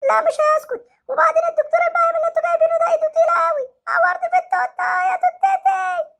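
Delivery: put-on hurt boy threatening the doctor with his dad; sulky hurt child. Same child shouting.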